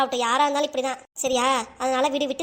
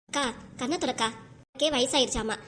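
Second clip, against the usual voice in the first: ordinary talk, nothing marked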